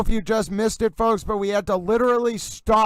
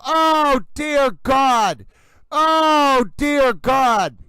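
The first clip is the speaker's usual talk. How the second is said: near-shouted alarm, each exclamation punched; shocked shouting; 6 semitones higher than usual. Real, not acted. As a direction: cry out in real horror. Reacting live to shocking trial moment.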